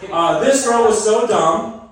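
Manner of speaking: drawn out, high and loud; slow emphatic setup. Acted. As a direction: stretch it out like a catchphrase. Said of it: "I need you guys to say".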